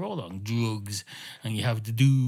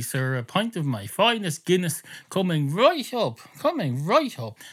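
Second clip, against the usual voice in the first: sing-song showman barman, pitched higher; showy barman; 6 semitones higher than usual. Acted. Barman shows off to the cat with a sing-song flourish. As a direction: ham up the coming right up with flair. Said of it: "Why are you saying it like that?".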